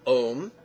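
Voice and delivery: OM as one long syllable, rising; syllable drill. Shown as a demonstration. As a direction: model "Om" with a lifted, questioning end. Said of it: "pronunciation drill".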